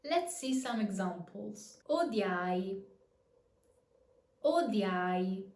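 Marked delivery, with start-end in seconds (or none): 1.9-5.4 "Odiai" with the first i gliding like a Y; careful demo of "odiai", "dya-i" in one glide. Shown as a demonstration. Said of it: "The E turns into a Y sound".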